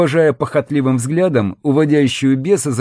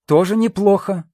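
higher-pitched, amused approval; amused scoff; 8 semitones higher than usual. A narrator's reading. Woman weighing the offer for her captive, amused scoff. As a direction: toss it off with a little scoff. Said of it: "хмыкнула стерва".

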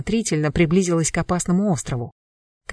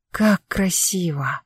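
slow, breathless whisper of awe; slower than usual. Acted. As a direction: breathe it out in wonder. Heroine gasps at island.

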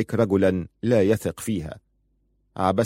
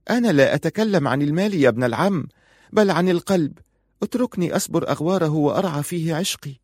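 higher-pitched wife correcting him; wife, pleading from the heart; 7 semitones higher than usual. A narrator's reading. Wife tells husband she means the heart, pleading. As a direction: plead for love, voice lifted.

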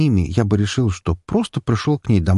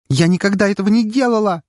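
hysterical exclamation; 13 semitones higher than usual. A narrator's reading. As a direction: protest with rising panic. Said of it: "истерически воскликнула она". (истерично, высоко и громко)

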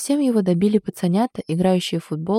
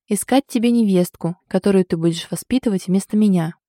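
plain reading voice

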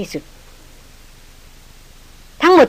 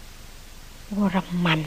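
much softer, slow and wondering; a bewildered woman murmurs a strange name, much softer; puzzled murmur; 4 semitones lower, 12 dB quieter, much slower than usual. Acted. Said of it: "ดารินพึมพำกับตนเองอย่างสุดฉงน".

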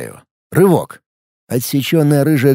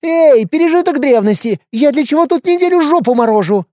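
put-on crazy girl voice; 13 semitones higher, 3 dB louder than usual. Unhinged chaos girl taunting.

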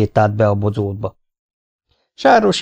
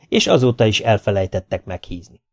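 ordinary delivery

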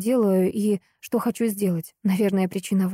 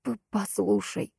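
soft, slow, stammering mumble; mumbling; 5 dB quieter, slower than usual. Acted. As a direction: mumble it through the pain. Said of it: "пробормотал он".

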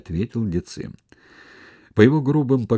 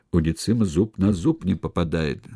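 ordinary delivery, nothing marked

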